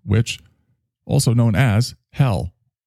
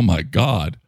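ordinary talk

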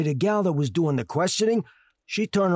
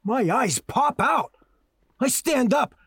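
ordinary talk, nothing marked

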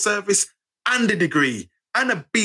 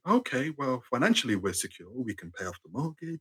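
much lower and softer, dry and sensible; mock sensible lottery winner; sober calm talk; 5 semitones lower, 9 dB quieter than usual. Lottery winner to family, mock calm and sensible. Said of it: "you wouldn't sit there with your family to say".

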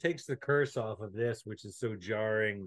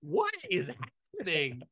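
baffled disbelief; 5 semitones higher than usual. Retold or imitated. Viewer reacting to a prequel alien, high baffled squeak. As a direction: squeak it out, totally lost. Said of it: "and you're like".

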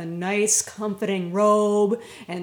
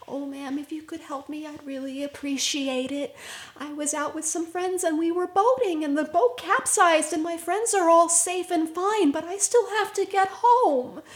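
put-on timid girl; 7 semitones higher than usual. Lost girl pleads for a ride.

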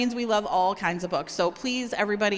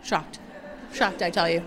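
mock shock; 5 semitones lower than usual. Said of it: "I'm just the straight woman to that".

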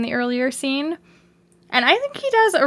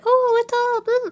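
high, drawn-out cutesy pet talk; put-on high baby-talk voice; 13 semitones higher, slower than usual. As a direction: coo slowly as if to a pet. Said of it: "that's how I talk to my pets".

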